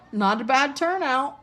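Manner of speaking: lightly boastful host; proud. A narrator's reading. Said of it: "said Nearly Headless Nick proudly".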